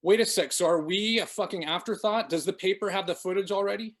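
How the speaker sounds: high, loud sudden outburst; blurted out. A narrator's reading. Vicky realizing the paper may have the footage, blurted alarm. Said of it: "she blurted out".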